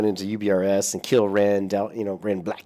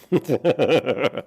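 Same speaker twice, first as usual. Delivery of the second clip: higher chuckle after a joke; laugh; 4 semitones higher, 4 dB louder than usual. Real, not acted. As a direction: laugh at your own threat. Laughs at his own threat.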